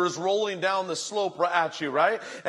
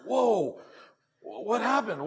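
higher-pitched acted surprise; taken aback; 4 semitones higher, slower than usual. Pastor reacting to a member quitting, startled. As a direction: play a pastor caught off guard.

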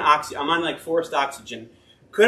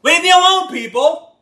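annoyed pastor; 2 semitones higher, 5 dB louder than usual. Pretend. Jesus voiced as a fed-up pastor turning the crowd away, mock annoyed. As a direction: shoo the crowd away like a put-out preacher.

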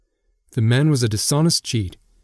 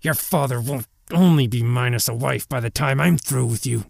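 higher-pitched, menacing snarl; angry, spiteful warning; snarled threat; 3 semitones higher than usual. A narrator's reading. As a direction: threaten them through a snarl. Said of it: "he snarled".

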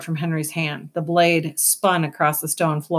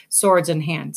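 ordinary delivery, nothing marked

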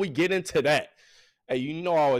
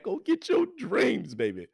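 hype shout; 8 semitones higher than usual. Real, not acted. Host pumping up listeners.

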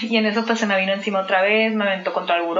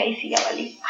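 very soft, barely voiced aside; hushed dread; 6 dB quieter than usual. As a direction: say it under your breath as a retold thought. Retold panicked thought, first take.

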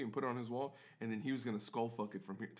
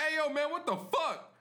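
mock angry shout, pitched way up; angry customer; 5 dB louder than usual. Angry customer confronting the bartender, mock furious yell. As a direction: yell it like an outraged meathead, high and loud.

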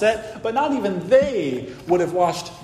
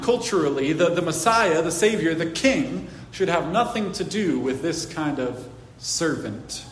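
ordinary talk

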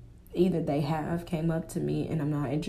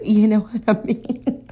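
laughing; 6 semitones higher, 7 dB louder than usual. Real, not acted. Laughing after a joking quote.

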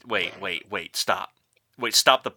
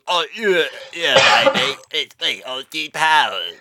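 loud, garbled mock swinger voice; Austin Powers mid-surgery; 3 semitones higher, 7 dB louder than usual. Mocking cohost as spy waking in vocal surgery, garbled. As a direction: mangle it as if operated on mid-sentence. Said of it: "woke up in the middle of vocal surgery".

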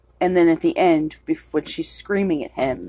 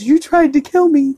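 accusing yell; 9 semitones higher, 7 dB louder than usual. Retold or imitated. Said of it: "And he goes".

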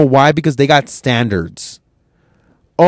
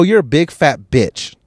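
ordinary talk

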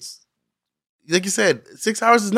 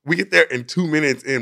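usual speaking voice

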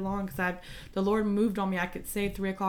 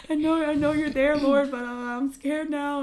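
high, louder mock-scared plea; 8 semitones higher, 8 dB louder than usual. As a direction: retell a panicky prayer, pitched up and loud. Retelling own frightened prayer.

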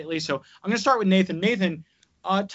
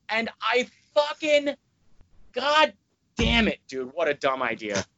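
exasperated; 4 semitones higher than usual. Real, not acted. Fuming over the tattoo.

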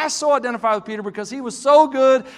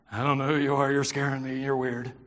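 low, much softer deadpan aside; imagined inner thoughts; 10 semitones lower, 9 dB quieter, faster than usual. Retold or imitated. Preacher voicing the disciples' silent reaction to Jesus, muted unspoken thought. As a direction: drop right down, voicing their private unease. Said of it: "That was probably what they were thinking".

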